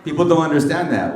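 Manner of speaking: ordinary talk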